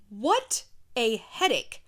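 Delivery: ordinary talk, nothing marked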